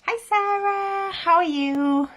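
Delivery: ordinary talk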